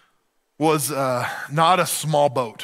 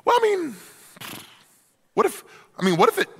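higher, hesitant sheepish squeak; sheepish Noah; 14 semitones higher, much faster than usual. Retold or imitated. Noah floundering before the neighbor, squeaky and hesitant. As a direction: stall for time, embarrassed.

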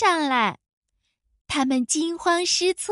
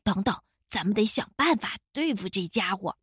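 soft, low, hurried undertone; hushed; 6 semitones lower, 6 dB quieter, faster than usual. A narrator's reading. Crow plots with his friend against the wolf, hushed. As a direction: confide it softly and quickly. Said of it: "花袜子却轻声告诉他".

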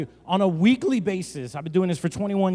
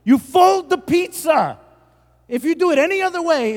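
high, loud, preached decree; mock outrage; 12 semitones higher, 7 dB louder than usual. Pastor laying down the pizza rule, mock outrage. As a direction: proclaim it like comic gospel.

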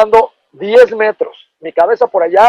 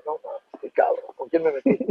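whispered dread; 4 semitones higher, 12 dB quieter than usual. Retold or imitated. Scared thought after a threat.

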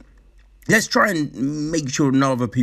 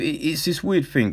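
normal talking voice

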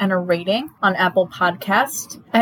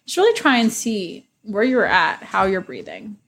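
guided meditation. Acted. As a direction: ease listeners gently into calm.

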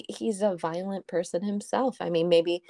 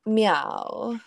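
mock meow; 4 semitones higher, 3 dB louder than usual. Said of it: "walking around saying".

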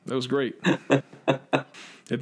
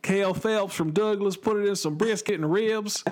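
higher-pitched mock Southern accent; Cale Phelps; 7 semitones higher than usual. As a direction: put on a high Southern barbecue-man accent. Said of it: "whose accent do I put it in?".

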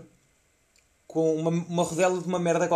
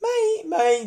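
put-on much higher, louder whining like a fourteen-year-old; teen whimpering mom, pitched up; childish whine; 14 semitones higher, 6 dB louder than usual. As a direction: whine mom like a scared kid. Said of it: "do nada catorze anos".

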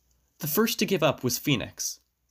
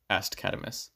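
ordinary delivery, nothing marked

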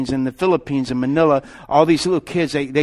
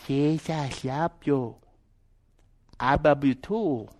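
put-on slow, hushed broken English with Filipino accent; soft Filipino accent; 5 dB quieter, slower than usual. Filipino woman speaks in a little boy's ear, hushed Filipino accent. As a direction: imitate her gentle Filipino-accented murmur, slowly. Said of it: "And she'd whisper in his ear".

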